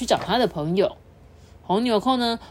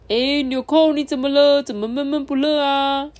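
toy train voice; 7 semitones higher, 5 dB louder than usual. Acted.

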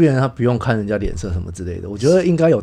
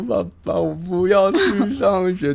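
high, slow, mock-tearful little girl; hammed-up child pleading with daddy; whiny crying child; 8 semitones higher, much slower than usual. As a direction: play the kid begging not to go to school. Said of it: "哭哭啼啼的说".

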